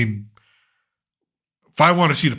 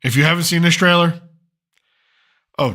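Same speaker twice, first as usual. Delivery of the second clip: ordinary delivery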